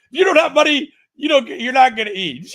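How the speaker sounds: high, loud imitation of the angry man; quoting the man from Ghana in a loud, high mock shout; mock shouted rant. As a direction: shout it back like the man from Ghana, half laughing. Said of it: "I can't do a Ghanaian accent".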